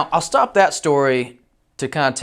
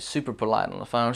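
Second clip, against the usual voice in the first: normal talking voice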